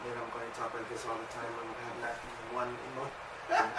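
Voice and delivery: a lightly done flat, emotionless voice, low and soft; mock monotone. Preacher mocking people told not to feel, in a deadpan. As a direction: mimic someone with no feeling, lightly. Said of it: "Don't be emotional".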